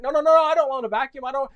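put-on high, loud, rapid-fire kid's objection; frantic protest. Johnny refusing to vacuum, high frantic protest. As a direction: tumble over the words refusing.

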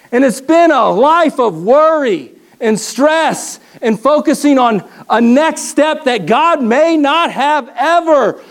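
ordinary talk, nothing marked

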